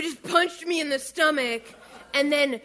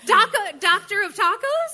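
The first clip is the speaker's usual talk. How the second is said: incredulous; 9 semitones higher, 7 dB louder than usual. Real, not acted.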